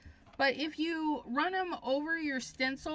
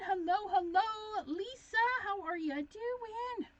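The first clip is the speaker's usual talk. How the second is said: high-pitched greeting; 9 semitones higher than usual. Real, not acted. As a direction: greet them way up high, stretching the words. Greeting viewers as they arrive.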